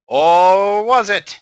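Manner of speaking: loud, slow ominous question; milk the cliffhanger; dramatic twist. Acted. Dramatic twist after "The end.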